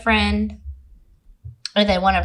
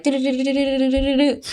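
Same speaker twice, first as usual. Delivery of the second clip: quick, higher run of do-do-do; rapid robotic processing sound; brain computing noise; 5 semitones higher, faster than usual. Retold or imitated. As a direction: imitate a computer loading up. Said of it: "my brain starts populating".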